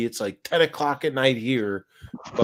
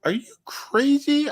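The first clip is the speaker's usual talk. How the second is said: incredulous. Retold or imitated. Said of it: "I'm like".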